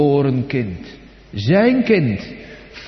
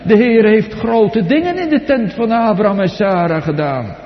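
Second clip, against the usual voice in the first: jubilant preaching; 4 semitones higher than usual. Acted. Preacher proclaiming Abraham's joy to the church, jubilant. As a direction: preach the joy out full force.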